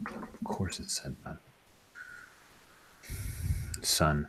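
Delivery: soft unvoiced knowing reply; whispering. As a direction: hush it, wearily knowing. NPC dismissive whisper.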